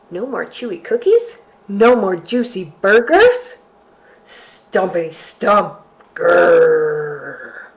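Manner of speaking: a hint of a snarling, grumpy bear; grumbling bear. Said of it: "He snarled and grumbled".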